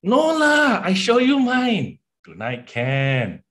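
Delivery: high, loud Singlish brag; Singlish accent. Pretend. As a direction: show off in cheeky Singlish. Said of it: "I'm Peranakan".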